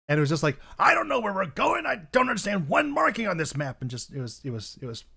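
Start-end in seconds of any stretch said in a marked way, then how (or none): 0.8-3.8 put-on high, loud muffled drunk captain; drunken captain. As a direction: slur it like a lost drunk sea captain. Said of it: "this drunken captain".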